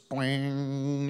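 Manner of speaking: imitated spring twang, drawn out; cartoon boing. Preacher shows his wife sitting up in bed, cartoon boing. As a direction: make a cartoon spring noise. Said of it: "Like one of those".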